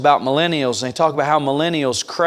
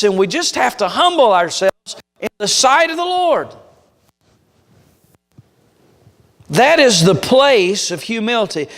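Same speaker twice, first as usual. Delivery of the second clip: fervent pulpit climax, rising and pressing; preaching at full force; 9 semitones higher than usual. Acted. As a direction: drive it home to the congregation.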